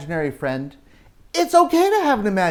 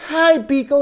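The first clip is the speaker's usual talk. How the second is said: Alice greeting; 8 semitones higher, 5 dB louder than usual. Acted.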